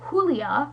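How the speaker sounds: "Julia" said with an H sound, HOO-lee-ah; "Julia" Spanish-style, J turned to H; nickname pronunciation. Shown as a demonstration. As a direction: say "Julia" as Hoo-lia, rising at the end. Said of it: "as Kayla likes to call her".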